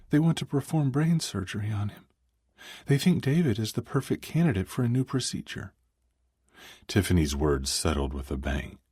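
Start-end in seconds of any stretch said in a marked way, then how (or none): 0.1-5.7 female voice. Acted. Tiffany voiced, upset.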